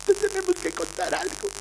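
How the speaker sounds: high, tearful mock-child confession; hammed-up sobbing child voice; crying little girl. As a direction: imitate a small kid bawling out a confession. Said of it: "las tengo a dos enanas llorando".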